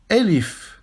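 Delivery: Elif repeated clearly as a model; Arabic letter name. Shown as a demonstration. Teacher repeating Elif for students, clearly. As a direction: say Elif crisply for repetition. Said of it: "Répétez après moi".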